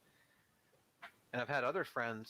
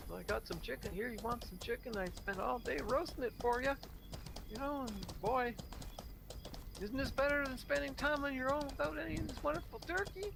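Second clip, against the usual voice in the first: pushy holiday host; 12 semitones higher than usual. Retold or imitated. Mocking a smug host pushing food on a guest, high and overbearing. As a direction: lay on the hospitality too thick.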